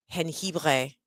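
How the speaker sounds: "Jengibre" with a throaty French r; "Jengibre", the r rasped in the throat; guttural French r. Shown as a demonstration. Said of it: "I do the French, whatever that is in my throat".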